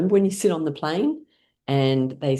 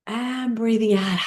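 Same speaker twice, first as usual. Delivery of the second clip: guiding the exhale; 6 semitones higher, slower than usual. Acted. Guides breathing.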